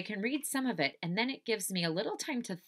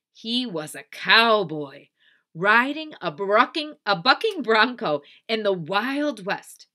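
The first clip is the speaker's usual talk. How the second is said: loud, dramatic storytelling; story narration; 4 semitones higher, 9 dB louder than usual. A narrator's reading. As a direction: savour it grandly for young listeners.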